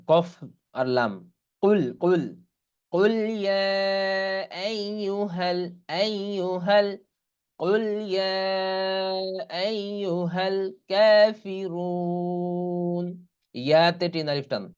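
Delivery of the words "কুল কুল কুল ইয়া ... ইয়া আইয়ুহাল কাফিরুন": slow melodic tajweed chanting; Quran recitation. Shown as a demonstration. Teacher reciting the surah for students, slow chant. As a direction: draw the vowels out in recitation.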